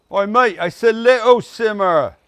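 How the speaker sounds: loud cockney shout over the pot; mock London shout; London accent. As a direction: bark it like an East London geezer. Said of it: "bust out your accent".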